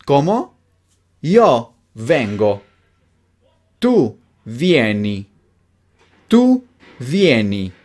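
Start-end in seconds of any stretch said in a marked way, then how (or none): 1.2-7.7 slow, each word set apart; slow modelling. Shown as a demonstration. Teacher gives learners the conjugation to repeat, slowly.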